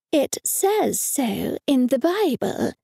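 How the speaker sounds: slow, condescending explaining; slow explanation. Acted. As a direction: spell it out as if to children. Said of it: "Mum explained slowly".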